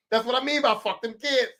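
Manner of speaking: ordinary talk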